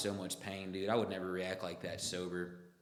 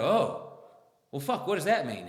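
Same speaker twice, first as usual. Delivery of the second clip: much higher and louder, startled; shocked outburst; 9 semitones higher, 10 dB louder than usual. Retold or imitated. Hearing about two massive kidney stones, a shocked outburst. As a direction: jolt at the bad news.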